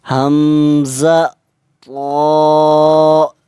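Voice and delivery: each letter drawn out and even; slow qaida spelling. Shown as a demonstration. Teacher spells slowly, pausing for students to repeat. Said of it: "मैं जब एक हर्फ को पढ़ लूँगा".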